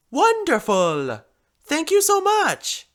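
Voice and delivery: high, loud, triumphant cry; delighted operatic gush. A narrator's reading. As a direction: exclaim it in triumph, stretched out. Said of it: "she said triumphantly".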